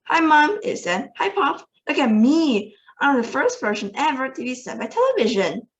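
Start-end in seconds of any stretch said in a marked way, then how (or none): 0.0-0.6 put-on a higher, louder little-boy squeak; tiny voice. A narrator's reading. Tiny boy greeting his mother from the TV, higher squeak. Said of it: "A tiny voice, no louder than the squeaking of a mouse". 1.2-5.7 put-on a higher little-boy voice; proud tiny boy showing off. A narrator's reading. Tiny boy boasting to his parents, higher squeaky voice. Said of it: "A tiny voice, no louder than the squeaking of a mouse".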